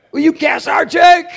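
high, loud jeering shout; mocking shout. Furious manager yelling at the departing worker, loud mocking shout. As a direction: shout it like a sneering boss.

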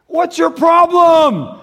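slow, high mock rage; mock angry snap. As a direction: play the rude, ungodly driver. Acting a rude driver.

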